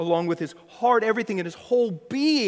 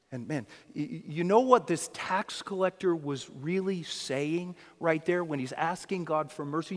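ordinary delivery

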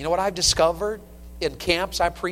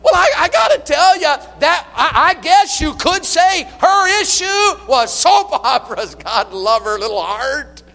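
laughing; 11 semitones higher, 11 dB louder than usual. Real, not acted. Laughing at his own story.

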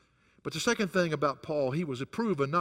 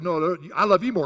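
louder, quicker teasing insistence; fast playful retort; playful one-upping; 3 semitones higher, 7 dB louder, faster than usual. Retold or imitated. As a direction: win the love-you-more game. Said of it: "I said".

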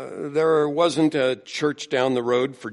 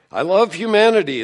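mock outcry, higher and louder; Linus crying out; 8 semitones higher, 10 dB louder, faster than usual. Preacher quoting Linus from Peanuts, a hammed-up cry. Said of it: "who cries out".

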